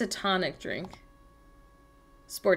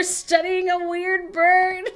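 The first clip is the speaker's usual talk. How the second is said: lightly hushed wildlife narrator, slow; mock Nat Geo narration, drawn out; nature documentary voice; 8 semitones higher, 3 dB louder, much slower than usual. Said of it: "like a Nat Geo author".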